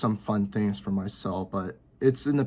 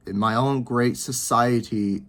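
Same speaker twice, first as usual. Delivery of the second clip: ordinary talk, nothing marked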